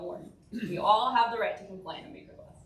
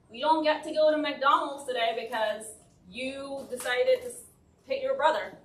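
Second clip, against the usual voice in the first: slightly higher, louder scolding parent voice; mock parent scolding; 4 semitones higher, 5 dB louder than usual. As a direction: play the fed-up parent at the child. Parent scolding child example.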